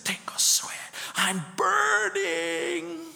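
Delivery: hushed, drawn-out whisper rising to a high wail; eerie whisper. Retold or imitated. Preacher recalling the patient's cry, eerie slow whisper. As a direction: breathe the words like a haunted voice. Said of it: "and I'm hearing this".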